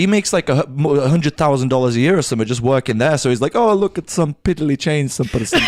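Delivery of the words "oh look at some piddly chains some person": mock Dubai accent. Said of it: "What accent was that?".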